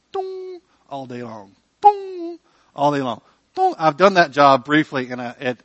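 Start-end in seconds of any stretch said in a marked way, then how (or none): 1.8-3.7 high, drawn-out mock register beep; scanner beep. Pastor mimics the checkout scanner's endless beep, high and drawn out. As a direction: sing the scanner's dong like a machine.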